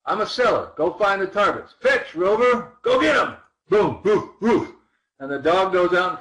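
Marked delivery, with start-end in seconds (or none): 0.1-3.4 rising, louder call to a dog. Acted. Coach voicing himself sending the tool, rising calls. 3.7-4.7 mock bark. Imitating a dog.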